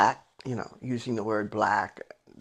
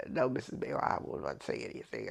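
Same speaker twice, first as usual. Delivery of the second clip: much lower, much softer, meek reply; cowed teacher; 11 semitones lower, 10 dB quieter than usual. Retold or imitated. Teacher giving in to the mother, low and meek. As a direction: shrink back like a scolded man. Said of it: "he said".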